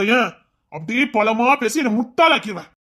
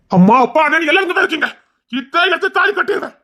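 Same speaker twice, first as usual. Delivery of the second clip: defiant shout; 7 semitones higher than usual. Acted. Threatening the family.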